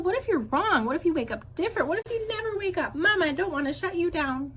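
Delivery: high, fast, worried little gosling voice; a young bird panicking about losing his mama; high anxious child pleading; fretful gosling. Acted.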